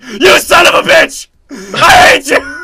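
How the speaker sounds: high, wailing furious scream, last words stretched out; hammed-up meltdown at an enemy; enraged shouting. Acted outburst at the seatmate.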